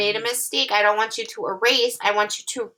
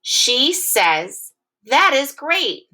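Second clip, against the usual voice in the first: higher, slow, excited "That is great"; excited reading; 6 semitones higher, 4 dB louder, much slower than usual. Shown as a demonstration. Teacher reading the new sentence with excitement. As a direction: read it out with excitement. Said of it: "Here's the sentence".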